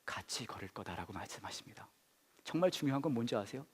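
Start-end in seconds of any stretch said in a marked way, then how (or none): 0.0-1.9 whisper. Acted. Preacher hushes to a whisper.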